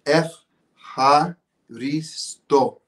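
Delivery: F, ha, risto in separate pieces with pauses; syllable-by-syllable demo. Shown as a demonstration. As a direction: teach F, ha, risto slowly, piece by piece. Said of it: "break it down for me".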